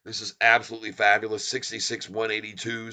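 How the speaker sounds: ordinary delivery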